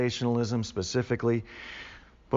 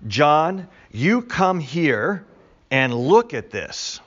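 slow, deliberate call, starting raised; emphatic summons; 3 semitones higher, 4 dB louder, much slower than usual. Acted. Creature calls John over to see, emphatic summons. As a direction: beckon firmly, word by word.

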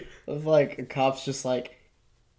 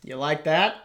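ASMR whisper. Acted. Hosts trying a podcast in ASMR, whispered. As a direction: murmur like an ASMR video. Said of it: "podcast in A S M R".

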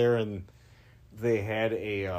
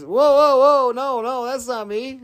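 high, loud retold protest; alarmed denial; 12 semitones higher, 10 dB louder than usual. Manning stopping the fan's announcement, alarmed and loud. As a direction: hurriedly call off the mistake, voice jumping up.

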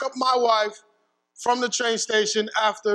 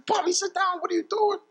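higher, startled wife's voice; wife stopping him; 10 semitones higher than usual. Retold or imitated. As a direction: voice the wife pulling him back, high and sharp. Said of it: "she stops me".

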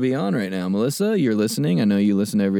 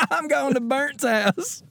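higher, slow Southern drawl; Southern accent; 14 semitones higher, slower than usual. Retold or imitated. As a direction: drag it out like a Southern guy. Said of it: "Southern guy saying Bert".

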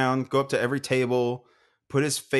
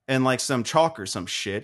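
ordinary talk

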